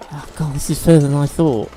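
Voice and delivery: usual speaking voice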